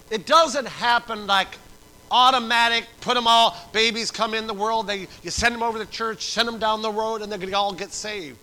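loud, high pulpit exhortation; full-force preaching. Real, not acted. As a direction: hammer it home to the congregation.

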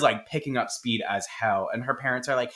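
ordinary talk, nothing marked

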